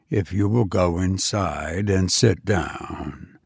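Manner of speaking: low, soft, even machine voice; an unmoved robot repeating its order. Acted. Robot calmly answering a frantic parent, low and soft.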